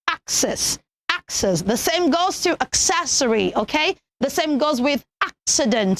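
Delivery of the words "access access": access as "AK-sess"; two separate takes of access with a clear k; correct pronunciation. Shown as a demonstration. Teacher repeating the word for children, careful access. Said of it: "you say access".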